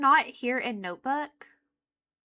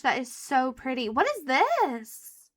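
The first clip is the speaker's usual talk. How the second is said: usual speaking voice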